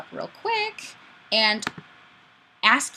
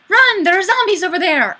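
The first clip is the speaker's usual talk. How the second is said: loud, high panicked cry; mock alarm; 9 semitones higher, 8 dB louder than usual. Streamer reading a viewer's comment as a loud panicked warning. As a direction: yell it like zombies are coming.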